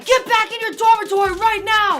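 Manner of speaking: retold officer yell. Officer ordering student to dorm, mock yell. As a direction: bark it like an angry cop. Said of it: "this officer starts yelling".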